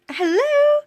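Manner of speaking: very high, loud squealed hello; delighted greeting. Real, not acted. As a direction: greet them with a drawn-out squeal. Greets coach arriving on stream.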